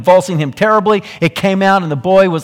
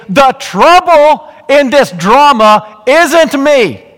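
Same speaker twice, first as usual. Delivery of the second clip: impassioned preaching; 7 semitones higher, 7 dB louder, slower than usual. Acted. Preaching at full force.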